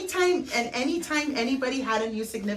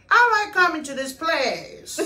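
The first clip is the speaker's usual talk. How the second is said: put-on slow, higher Mexican accent; 4 semitones higher, 3 dB louder than usual. Tío Chon in the dad's Mexican accent, slow and higher. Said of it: "the Mexican accent".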